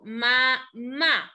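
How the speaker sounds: "ma, ma" split into two separate syllables, the second stressed; sílaba por sílaba. Shown as a demonstration. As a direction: say "ma, ma" with a break, landing on the second. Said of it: "¿cuál será la sílaba tónica?". ("ma, ma" separado, con acento en la segunda)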